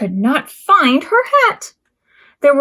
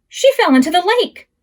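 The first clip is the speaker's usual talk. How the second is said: ordinary delivery, nothing marked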